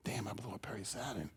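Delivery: whispered thought. Retold or imitated. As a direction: breathe it to yourself in disbelief. Private thought after the tryout.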